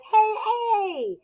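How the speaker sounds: high, playful voice of the magic E; a high, bright-voiced silent E giving orders; magic E talking. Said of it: "and it says".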